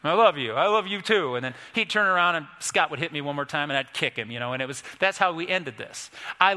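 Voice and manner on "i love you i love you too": louder, grudging reply under threat; hammed-up, unwilling declaration of love; mock forced affection. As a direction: imitate boys made to say it. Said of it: "you know, like, under threat".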